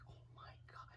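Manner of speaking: very soft, voiceless gasp of words; barely audible, awed whisper; stunned whisper. Retold or imitated. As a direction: whisper it in retold shock. Retold reaction to terrifying scream.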